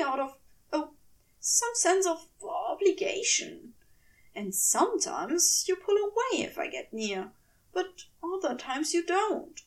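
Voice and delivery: a hint of a flustered, earnest angel; fussy angel voice. Anxious angel asking his partner a tender question. Aziraphale voiced in fanfic reading.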